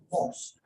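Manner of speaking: "Mosque" as one syllable ending in k; correct mosque. Shown as a demonstration. Teacher answering how to pronounce the word, correct mosque. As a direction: close "Mosque" straight on the k. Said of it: "How do you pronounce".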